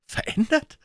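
very high, rising disbelief; incredulous cry. A narrator's reading. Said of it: "rief Paul".

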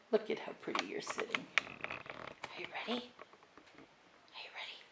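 soft whispering tease before the throw; whispered build-up. Real, not acted. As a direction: whisper to get him ready.

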